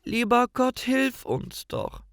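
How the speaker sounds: lightly voiced crying girl; a touch of tearful plea. A narrator's reading. Gretel pleads to God, high and mildly tearful. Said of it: "fängt an zu weinen und ruft".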